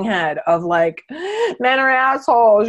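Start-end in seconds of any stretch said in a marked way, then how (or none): 1.1-1.5 sharp, high mock gasp of shock. Acting out the floating head. 1.6-2.7 higher, louder hammed-up outburst; mock outburst. Acting out the floating head.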